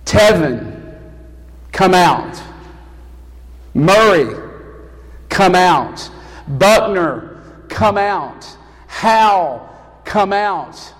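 each name called out loudly, then a pause; ringing, measured summons, falling at each end; loud summoning call. Acted. Preacher as Jesus calls believers from their graves, loud summons. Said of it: "he's going to say".

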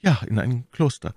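firm reply with a falling close; confirming answer. A narrator's reading. Milady confirms the news.

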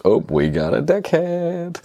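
very high, drawn-out sing-song jeer; hammed-up singsong alert; mocking announcement. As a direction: call it out slowly on a long held high note. Whole shop announces an idiot.

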